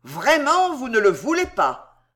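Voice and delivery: high, loud mocking disbelief; jeering, high-pitched provocation; provoking taunt. A narrator's reading. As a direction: goad him with exaggerated surprise. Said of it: "d'un air provoquant".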